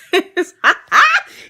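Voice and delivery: loud, high burst of laughter; amused laugh. Real, not acted. Storyteller tickled by her own two-sentence statement, laughing. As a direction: crack up loudly, high-pitched.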